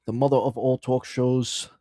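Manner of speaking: a light, jokey Scottish accent; Scottish brogue, lightly put on. Shown as a demonstration. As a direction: roll it out like a mock Scottish host. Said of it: "we make a Scottish accent".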